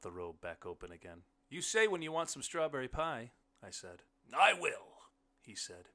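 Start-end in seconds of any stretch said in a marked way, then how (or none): 1.5-3.3 raised, higher conversational voice; loud, high offer across the room; calling to the guest. A narrator's reading. Narrator character addressing his guest. 4.3-5.1 loud, high character voice; booming, raised-pitch guest; hearty blind man. A narrator's reading. Blind man's line in story.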